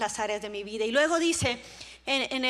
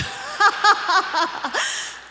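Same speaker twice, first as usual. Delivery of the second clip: loud, high burst of laughter; hearty laugh; 7 semitones higher, 9 dB louder than usual. Real, not acted. Preacher amused by her own joke, loud laughing.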